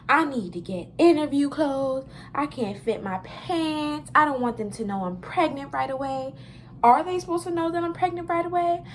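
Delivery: panicked. Retold or imitated. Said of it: "I'm freaking out".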